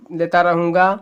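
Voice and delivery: usual speaking voice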